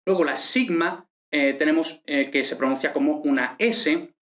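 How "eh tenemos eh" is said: ordinary talk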